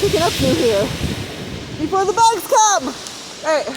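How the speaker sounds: loud, high yelling into the gale; shouting over wind. Real, not acted. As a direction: shout every word over the storm. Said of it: "I don't know if you can hear me".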